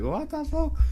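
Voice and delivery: high-pitched incredulous exclamation; mock disbelief. Host imitating listeners hearing the messy episode, mock disbelief. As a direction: squeal it like a baffled listener.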